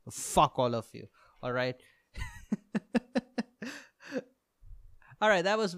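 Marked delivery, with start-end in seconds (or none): 2.2-3.8 high rising laugh. Real, not acted. Laughs at own rant.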